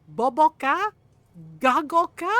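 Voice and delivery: loud, incredulous questioning of a scolding mom; mock scolding. Mom scolding her brothers in Tagalog, mock outrage.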